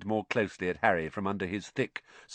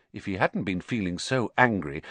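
ordinary delivery, nothing marked

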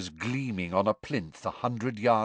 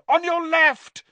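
high, loud urgent shout; bellowed warning; 6 dB louder than usual. A narrator's reading. Harry warning Cedric of the spider, loud shout. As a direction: yell the warning before it's too late. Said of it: "Harry bellowed".

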